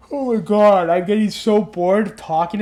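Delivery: speaking through a yawn; sleepy, yawning while talking. Real, not acted. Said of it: "I'm yawning nonstop".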